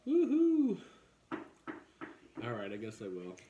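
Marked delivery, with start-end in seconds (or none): 0.1-0.8 excited whoop. Real, not acted. Cheers with the wine.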